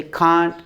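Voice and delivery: "कांट" with a long open a, British style; British "can't". Shown as a demonstration. Teacher demos British "कांट" for students. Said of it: "British और American का थोड़ा सा pronunciation difference है".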